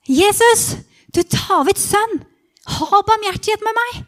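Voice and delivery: high, loud pleading cry; loud beggar's plea; calling out. Acted. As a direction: call out desperately for mercy across a crowd. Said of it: "Jeg roper".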